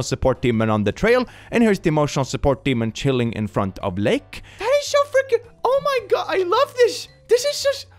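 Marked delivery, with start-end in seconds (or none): 4.6-7.9 high squeal of delight. Real, not acted. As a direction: squeal it out over something cute. Squealing at cute pictures.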